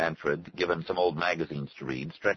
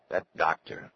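ordinary delivery

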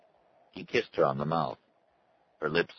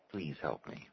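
soft, pleading voice; quiet plea; 2 semitones lower, 12 dB quieter than usual. A narrator's reading. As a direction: beg softly for help. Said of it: "he said".

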